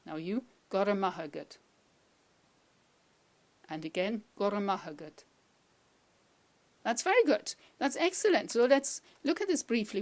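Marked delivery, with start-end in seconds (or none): 0.7-1.6 "Gore mahagat" run together in one flow; joined-up phrase. Shown as a demonstration. Teacher showing how you will say it, joined up. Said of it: "You will be saying it like this". 4.4-5.2 "Gore mahagat" run together in one flow; joined-up phrase. Shown as a demonstration. Teacher showing how you will say it, joined up. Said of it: "You will be saying it like this".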